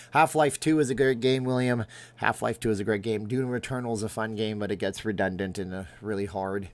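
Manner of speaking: ordinary talk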